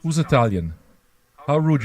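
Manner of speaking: normal talking voice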